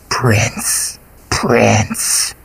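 slow, hungry creature whisper; monster whisper. A narrator's reading. Golgoroth crooning to the princess in a slow creature whisper. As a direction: whisper like a beast savoring prey.

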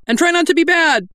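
high, loud nervous sermon; nervous preacher. Acted. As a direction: preach through panic.

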